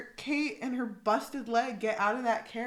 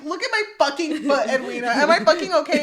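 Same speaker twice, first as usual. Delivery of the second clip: loud, higher irritated retort with laughter; mock exasperation; 4 semitones higher, 9 dB louder, faster than usual. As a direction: snap at a fussing sister, half laughing. [name] snapping at fussing sister.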